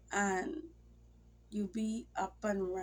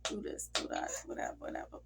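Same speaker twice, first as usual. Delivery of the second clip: imitating husband's instructions; faster than usual. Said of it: "He always will just give me instructions".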